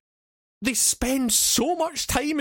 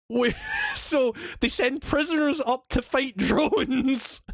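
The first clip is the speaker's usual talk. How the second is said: higher, slow, squeezed through laughter; cracking up; 5 semitones higher than usual. Real, not acted. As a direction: laugh helplessly while explaining the absurdity. Cracking up at absurd plot.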